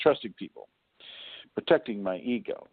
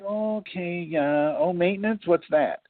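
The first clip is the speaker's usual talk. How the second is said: clueless voice; 8 semitones higher than usual. Acted. Imitating clueless car owner.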